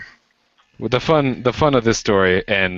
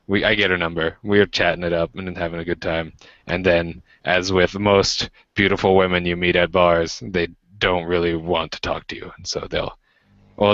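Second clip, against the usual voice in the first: ordinary delivery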